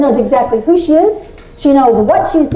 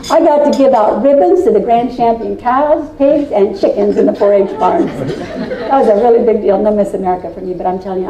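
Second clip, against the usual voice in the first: ordinary delivery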